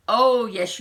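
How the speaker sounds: loud, warm royal correction; the king gladly contradicts; hearty reassurance. Acted. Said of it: "said the king".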